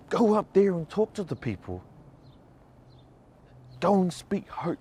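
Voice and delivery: high-pitched bossy push, voice put on; a friend firmly shoving you forward; urging friend. Pania telling her to go speak hope, high insistent urging.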